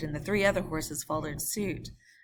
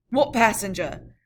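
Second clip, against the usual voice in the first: loud demand; 4 semitones higher, 9 dB louder than usual. Acted. Guard challenging.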